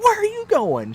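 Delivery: very high, loud panicked cry; mock hysterical wife; freaking out. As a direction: call after him in hammed-up panic. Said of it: "she's starting to freak out".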